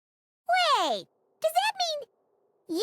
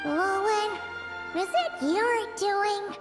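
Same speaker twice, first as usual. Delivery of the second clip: slow, drawn-out accusation; suspicious questioning; much slower than usual. Acted. Companion asking Owen if it is his doing, slow suspicion. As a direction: draw it out, suspecting the culprit.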